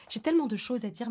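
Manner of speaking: usual speaking voice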